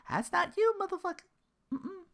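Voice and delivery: high squeaky mock voice, dropping to a mutter; jeering falsetto aimed at the streamer; mock high-pitched jab. Mocking the streamer in a high squeaky voice.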